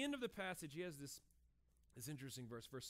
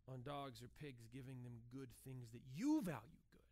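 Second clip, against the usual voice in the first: whisper; 10 semitones lower, 6 dB quieter than usual. Real, not acted. Aside to congregation.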